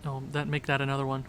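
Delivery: normal talking voice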